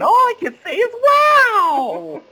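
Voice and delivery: very high, loud showy cry; exuberant character shout. Acted. Actor acting out his character at the show's end, exuberant shout. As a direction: burst out in a soaring mock-theatrical wail. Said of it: "I'm just coming up with Billy Elliot".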